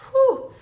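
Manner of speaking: loud high whew, holding back tears; tearful exhale. Real, not acted. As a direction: blow out a breath to steady the emotion. Emotional moment, fighting tears.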